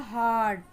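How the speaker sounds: "हार्ड" with a stretched, falling aah; drawn-out, clear "हार्ड"; long A demo. Shown as a demonstration. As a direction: hold the vowel in "हार्ड". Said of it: "बहुत ही long sound".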